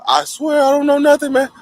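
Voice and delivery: high, pleading voice; tearful plea. Retold or imitated. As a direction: beg through tears. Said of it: "I said".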